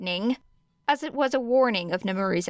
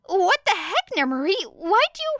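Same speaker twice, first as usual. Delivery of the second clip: much higher, rising flustered outburst; Hizashi voice; 12 semitones higher than usual. Acted. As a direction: squeak it out in alarm. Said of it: "answered Hizashi".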